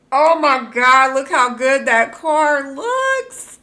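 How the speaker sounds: real loud excitement; excited yell. Real, not acted. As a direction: shout it in pure awe. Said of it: "if I'm screaming in your ear".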